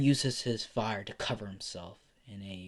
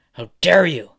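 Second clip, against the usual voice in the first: higher, much louder angry outburst; furious shout; 5 semitones higher, 11 dB louder than usual. Acted. Endeavor kicking Izuku for talking to his masterpiece, shouting in fury. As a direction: explode on the last demand. Last repeat, escalated to a shout.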